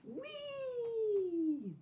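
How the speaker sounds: very high, long gleeful squeal. A narrator's reading. Doll on the swing squealing with glee. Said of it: "the doll squealed".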